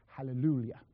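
imitated posh Anglican hallelujah; lightly put-on posh English accent. Anglican professor ending his opening declaration, very posh voice. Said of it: "in a very posh voice".

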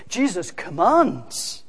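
ordinary talk

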